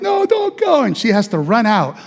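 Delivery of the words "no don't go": put-on high, desperate child's wail; a scared six-year-old begging his mom to stay; crying plea. Little boy clinging to mom, wailing high for her to stay.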